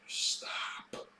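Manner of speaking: sigh, then a breathy whisper; whispered to himself. Real, not acted. Ranting speaker tells himself to stop, in a whisper. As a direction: hush yourself, stop. Hushed self-admonition mid-rant.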